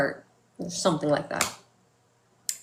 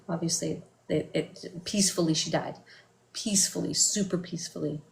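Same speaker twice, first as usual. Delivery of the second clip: hushed grieving voice; 8 dB quieter than usual. Real, not acted. Recounting mother's death.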